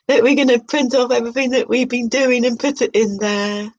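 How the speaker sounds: lightly put-on, upbeat classroom cheerleader; mock cheery group leader. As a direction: gush like a twee workshop host.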